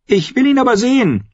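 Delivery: put-on very high boyish demand. A narrator's reading. Boy refusing to be put off, insistent. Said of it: "beharrte Edric lauter jetzt".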